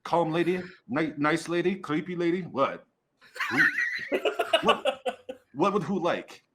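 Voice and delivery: high, loud little cook, confused; small high voice calling out questions; tiny kitchen voice. Acted. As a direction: grope for the word in a high North Country voice. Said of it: "this tiny little voice from the kitchen, North Country voice".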